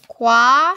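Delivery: kwa said alone, loud and held; syllable by syllable. Shown as a demonstration. Teacher sounding out a Korean syllable for the class, slow and clear.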